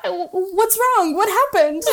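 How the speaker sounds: ordinary talk, nothing marked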